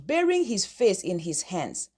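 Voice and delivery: ordinary delivery, nothing marked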